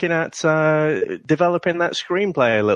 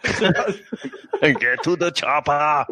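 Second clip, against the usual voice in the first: Schwarzenegger quote; 3 semitones higher, much slower than usual. Retold or imitated. Schwarzenegger quote through laughter.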